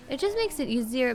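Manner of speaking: ordinary talk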